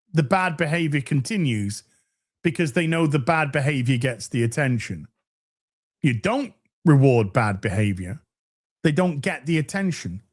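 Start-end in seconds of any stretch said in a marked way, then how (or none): none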